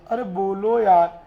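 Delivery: ordinary delivery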